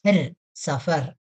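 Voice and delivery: "ർ, സഫർ" drawn out slowly, then blended with a half final r; slow sounding out. Shown as a demonstration. Teacher showing students how to read a Hindi word, slowly. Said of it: "സഫര എന്നല്ല വായിക്കുന്നത്".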